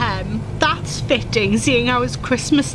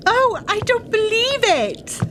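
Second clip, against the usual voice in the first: surprised exclamation; 8 semitones higher than usual. Acted. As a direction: gasp it out as the snow starts. Snow starts falling, daughter exclaims.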